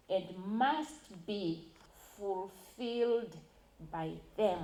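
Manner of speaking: must pressed slow and firm; stress on must. Shown as a demonstration. Speaker at a public hearing stressing duty, slow firm must. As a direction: lean hard on must, drawn out. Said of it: "I stress on the word must".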